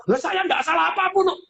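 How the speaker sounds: high, defensive mock protest; wrong tone. Imitating a spouse who answers in a defensive tone. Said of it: "Nadanya sudah begitu".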